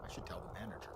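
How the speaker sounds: a quick private whisper; hushed note to self; whispered aside. Real, not acted. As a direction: whisper it to yourself.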